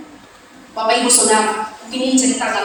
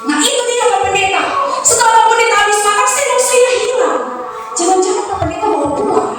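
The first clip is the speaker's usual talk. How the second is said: higher, rising accusation; mock-accusing mother, raised pitch; 7 semitones higher than usual. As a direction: catch him out, voice climbing high. Mother accusing pastor over spoon.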